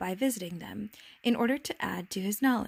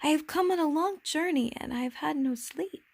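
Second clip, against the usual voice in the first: lightly voiced little prince, pitched higher; a higher, gently pleading prince; little prince, apologetic; 7 semitones higher than usual. Little prince explains his tiredness to the king, apologetic.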